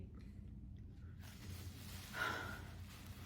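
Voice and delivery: soft weary sigh; long sigh. Real, not acted. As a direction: let out a tired breath. Tired after long day.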